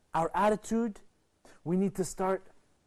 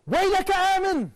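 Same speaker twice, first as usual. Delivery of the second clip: parents' cry; 13 semitones higher, 8 dB louder, faster than usual. Acted. Parents' cry to their son.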